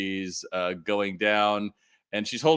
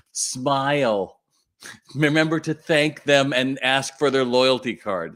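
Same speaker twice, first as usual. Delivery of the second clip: mock instruction; 5 semitones higher, 5 dB louder, slower than usual. Mocking manager's Post-it reminders to staff.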